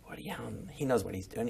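very soft, low murmur; under the breath; hushed aside. Retold or imitated. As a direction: drop right down as if thinking privately. Confiding an actor's trust in director.

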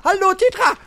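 high, bright hello; delighted greeting. Real, not acted. Finding the lost companion, calls out delighted. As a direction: greet her like you found her at last. Spots Citra and greets her.

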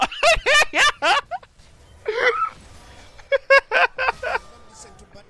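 high laugh breaking into a wail; shrieking laugh. Real, not acted. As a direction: laugh until it squeaks. Reacting to partner leaving.

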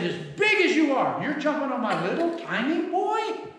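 high, loud mock outrage; retold dad's anger. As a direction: act out an angry father confronting a big kid. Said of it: "I got a little angry".

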